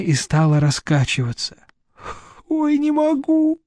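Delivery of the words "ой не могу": high, slow, drawn-out moan; muffled wail. A narrator's reading. Vera rocking with face in hands, muffled wail. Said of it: "Глухо зазвучал её голос".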